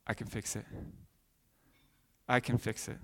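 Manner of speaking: the Savior leaning in to a sinner, softly reassuring; gentle Savior. Pretend. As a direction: lean in and promise it quietly.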